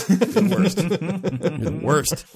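laughing through it, drawn out the second time; laughing exasperation. Real, not acted. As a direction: groan it with a laugh. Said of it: "It's palpable".

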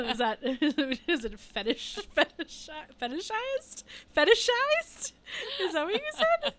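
high, loud, laughing question; laughing disbelief. Real, not acted.

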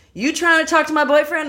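high, loud jealous challenge. Retold or imitated. Jealous girlfriend in Idaho confronting her over a guy, aggressively. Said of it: "this hick ass bitch comes up".

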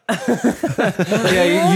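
usual speaking voice

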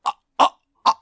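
breathy, unvoiced anime gasps "Ah, ah"; mock anime surprise noises. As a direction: gasp like a startled anime character. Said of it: "someone's surprised, they go".

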